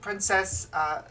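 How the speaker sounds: high, loud, slow servant announcing; maid voice. Acted. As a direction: announce the visitor like a polite maid. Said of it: "and she goes".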